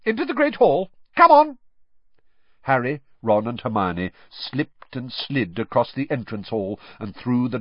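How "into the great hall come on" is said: high, loud, hurrying command; brisk order. A narrator's reading. McGonagall herds the wet students into the hall. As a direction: herd them in.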